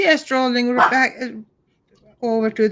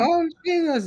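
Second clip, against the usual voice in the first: panicked; 8 semitones higher, 4 dB quieter than usual. Retold or imitated. Family panicking over thunder.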